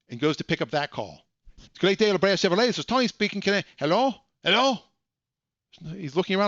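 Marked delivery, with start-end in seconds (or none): 1.6-4.8 high, loud greeting turning to rising hellos; phone greeting then puzzled hello. Retold or imitated. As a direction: chirp the greeting, then confused. Tony answers his own call.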